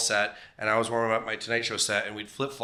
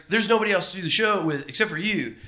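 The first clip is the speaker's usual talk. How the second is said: lightly voiced excited host, raised pitch; a hint of Fallon's pleading tone; playful retold host voice; imitating Jimmy Fallon; 7 semitones higher than usual. Fallon quote acted.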